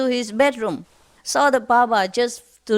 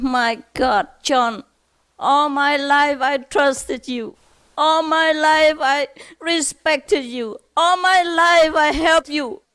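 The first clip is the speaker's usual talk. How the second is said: near tears; 5 semitones higher than usual. Acted. Betrayed father scolds his best friend, mock near-tearful reproach. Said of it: "nearly cried".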